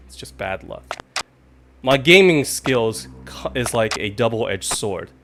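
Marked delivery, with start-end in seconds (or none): 0.1-1.0 a muttered whisper of excuse; whispered repeat. Shown as a demonstration. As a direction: whisper it to yourself, softer.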